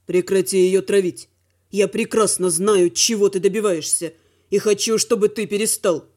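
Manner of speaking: low male voice; firm younger brother. A narrator's reading. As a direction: stand up to him, pitched low. Dima confronts his brother.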